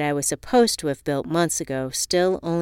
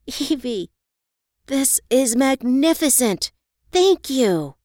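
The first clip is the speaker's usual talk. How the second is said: higher, delighted thanks; the bride thanking her friend for the gift, overjoyed; overjoyed Reva; 8 semitones higher, 4 dB louder, slower than usual. A narrator's reading. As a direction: gush with thrilled surprise. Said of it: "Reva gasped, jumped up".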